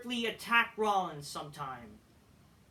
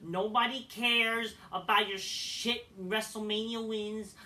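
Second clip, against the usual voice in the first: ordinary talk, nothing marked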